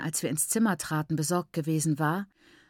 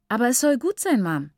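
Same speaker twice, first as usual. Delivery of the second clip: objecting, pitched up and louder; contradicting; 5 semitones higher, 4 dB louder than usual. A narrator's reading. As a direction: argue back at her mother. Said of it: "widersprach Nyasha". (widersprechend, lauter und höher)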